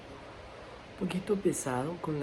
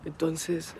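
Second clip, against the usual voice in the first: louder hoarse sick voice; 9 dB louder than usual. Real, not acted. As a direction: strain through a sore throat. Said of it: "mi voz ya se escucha un poquito mejor".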